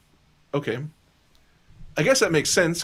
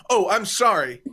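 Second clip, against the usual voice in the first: higher, a bit louder startled "Oh, I'm sorry"; startled apology; 6 semitones higher, 3 dB louder than usual. Retold or imitated. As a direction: blurt the apology as if caught walking in. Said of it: "I will audibly go".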